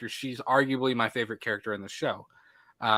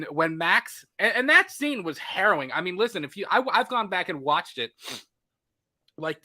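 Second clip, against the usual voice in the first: usual speaking voice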